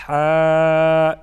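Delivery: long, loud ہا squeezed from mid-throat, as Arabic ح. Shown as a demonstration. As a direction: hold ہا from the middle of the throat. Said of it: "یعنی وسط حلق سے". (وسط حلق سے لمبا ہا)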